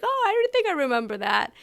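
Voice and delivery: mock innocent driver voice; driver pretending to forget. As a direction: play dumb like the shuttle driver. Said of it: "then he'll be like".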